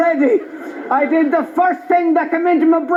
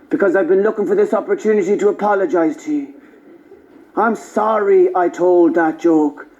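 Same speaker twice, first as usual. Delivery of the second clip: much lower, measured apologetic voice; mock-sincere apology; 9 semitones lower than usual. Comedian imagines apologising to the Travellers, low and earnest. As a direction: play a humble, earnest apology.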